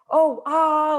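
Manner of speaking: high mock gasp of discovery; imitating an aha. Speaker imitates her own aha moment, high and loud. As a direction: act out the sudden relief. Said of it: "like this, like".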